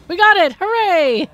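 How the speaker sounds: high, loud celebration; triumphant cheer. Real, not acted. Player celebrating that they got it, triumphant cheer. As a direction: cheer the win out loud, pitch up.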